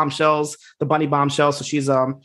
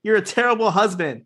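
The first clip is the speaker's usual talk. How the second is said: heated rant; 6 semitones higher than usual. Real, not acted. Ranting about a bad husband.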